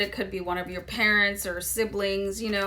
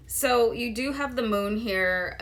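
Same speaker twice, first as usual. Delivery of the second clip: usual speaking voice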